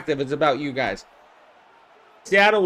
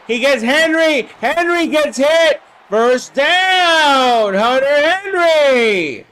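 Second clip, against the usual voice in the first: rising higher and louder to a drawn-out shout; excited play call; 7 semitones higher, 4 dB louder, much slower than usual. Real, not acted. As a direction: build the call up as the catch is made. Big completion in simulated game.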